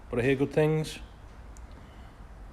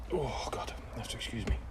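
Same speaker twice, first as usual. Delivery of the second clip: a little nauseous, quiet; feeling sick, held back; queasy; 5 dB quieter than usual. Real, not acted. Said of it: "Feeling a bit queasy".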